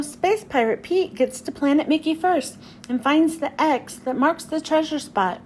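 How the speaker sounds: usual speaking voice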